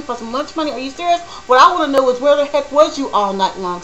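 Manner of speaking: scolding a husband who stayed out all night, loud and indignant; retold angry confrontation. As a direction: let her have it, indignant and loud. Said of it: "I said".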